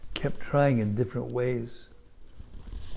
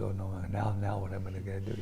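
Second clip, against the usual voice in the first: flustered mutter; 2 semitones lower, 5 dB quieter than usual. Acted. Disguised Krishna flustered aside.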